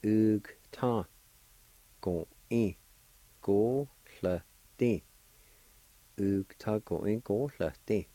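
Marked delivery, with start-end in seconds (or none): 0.0-5.0 ug, ta, go, in, go, sl, ti, all separated by pauses; syllable by syllable. Shown as a demonstration. Teacher giving flaxseed all together, syllable by syllable. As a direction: sound out each syllable from ug to ti. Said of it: "And all together".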